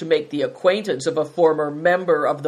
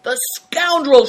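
high, loud outburst; outraged, exploding in anger; furious shout; 9 semitones higher, 7 dB louder than usual. A narrator's reading. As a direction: shout the insult. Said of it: "shouted Greencoat".

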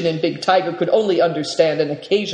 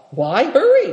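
higher, lively mild exclamation; crying out; 8 semitones higher, 4 dB louder than usual. A narrator's reading. Host protests to his departing guest, crying out higher. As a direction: call it out in protest, pitched up. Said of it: "cried the Marin".

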